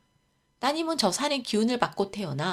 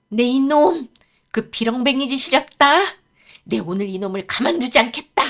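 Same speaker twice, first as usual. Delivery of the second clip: loud, raised-pitch villainous rage; furious outburst; 4 semitones higher, 9 dB louder than usual. Acted. Daughter finding her bedding stolen, vowing revenge in fury. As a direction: roar out a threat in fury.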